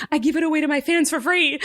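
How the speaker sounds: high, whiny mock panic; panicked excuse. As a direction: squeal out a desperate excuse. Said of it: "I'm, like, a panicked person".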